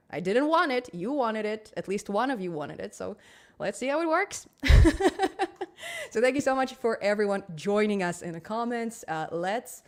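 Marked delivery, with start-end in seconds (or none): 4.5-6.0 loud high laugh; high burst of laughter. Real, not acted. Laughs about the missing eyeliner.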